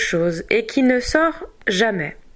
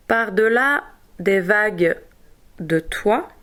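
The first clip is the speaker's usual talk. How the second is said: broken into small chunks with clear pauses; dictation in pieces. Shown as a demonstration. Teacher dictating to writers, piece by piece with pauses. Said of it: "par morceaux sous la dictée".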